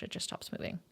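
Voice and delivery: chilling whispered last line. Acted. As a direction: let it die away into a whisper.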